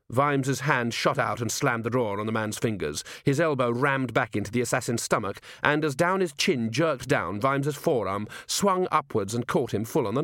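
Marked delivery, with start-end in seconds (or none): none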